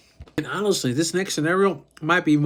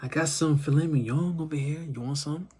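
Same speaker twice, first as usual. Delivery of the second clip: much softer, unbothered polite refusal; casual; 6 dB quieter than usual. Acted. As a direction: brush the offer off quietly and casually. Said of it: "And you just casually say".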